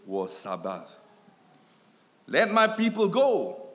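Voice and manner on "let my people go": raised, higher, louder proclamation; loud cry. Acted. Preacher voicing God's cry for his people, loud cry. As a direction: cry it out as a command. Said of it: "becomes God's cry".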